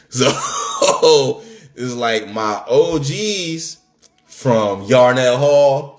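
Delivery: ordinary talk, nothing marked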